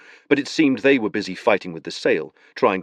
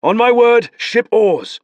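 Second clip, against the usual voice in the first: command; 14 semitones higher, 7 dB louder, slower than usual. Acted. Beron commanding rowers.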